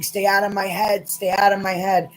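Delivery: screaming. Acted. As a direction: yell to force him out of your mind.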